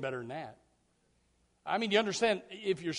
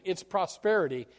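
ordinary delivery

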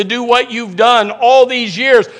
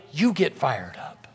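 low, slow and much softer; hushed reply; 7 semitones lower, 9 dB quieter, slower than usual. Acted. Acted reply to honey, low and hushed. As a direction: drop right down, drawing it out.

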